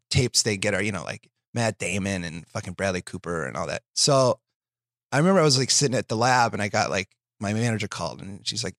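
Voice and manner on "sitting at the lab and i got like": normal talking voice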